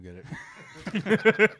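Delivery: slow laughing insistence; laughing while saying it. Real, not acted.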